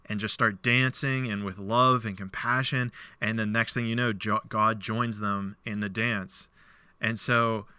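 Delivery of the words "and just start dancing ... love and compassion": ordinary talk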